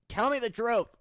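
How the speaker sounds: loud. Acted. Demanding honesty from teams.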